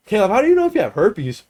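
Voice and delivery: high, loud, teasing fake-innocent ask; mock innocent question. Teasing classmate to provoke teacher.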